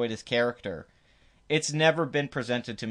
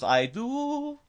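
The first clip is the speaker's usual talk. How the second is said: high put-on Apu voice, in character; a quick mock cartoon shopkeeper voice; Apu impression; 12 semitones higher than usual. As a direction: squeak it out as Apu. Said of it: "And he goes".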